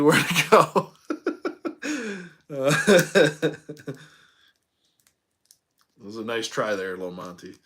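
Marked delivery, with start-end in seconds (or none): 0.0-3.6 breaking into real laughter mid-sentence; laughing. Real, not acted. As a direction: let a loud laugh burst through the words. Breaks into laughter at own joke.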